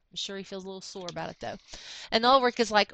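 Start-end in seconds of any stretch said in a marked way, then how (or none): none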